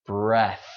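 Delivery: "breath" ending in a voiceless TH, only air. Shown as a demonstration. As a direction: let pure air out at the end of "breath". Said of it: "just air".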